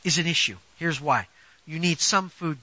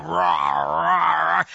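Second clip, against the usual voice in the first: imitated growling rah rah rah, low and rough; stomach growl; 3 semitones lower, much slower than usual. Preacher imitating a hungry stomach to the congregation, mock growl. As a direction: growl it like a hungry beast in your belly. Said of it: "It's literally growling at you".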